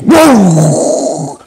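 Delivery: long, loud "woo" of a throw; effortful whoosh. Acted. Getting rid of the fireball, loud whooshing cry. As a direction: hurl it out in one big blast.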